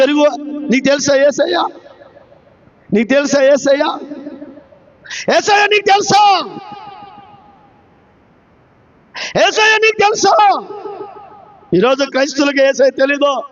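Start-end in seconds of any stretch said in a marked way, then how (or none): 2.9-4.0 lowered rhetorical question, rising end; restrained question. Real, not acted. Preacher asks do you know Jesus, held back and questioning. 5.4-10.6 high, called-out preaching question; shouted challenge. Real, not acted. Preacher demands do you know Jesus, raised and shouted.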